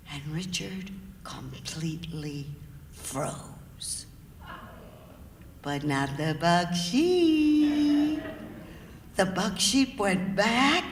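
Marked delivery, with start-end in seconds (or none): 0.1-8.2 hushed, much lower and softer; suspense whisper. A narrator's reading. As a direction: drop to a whisper for the freeze. Suspense moment in story.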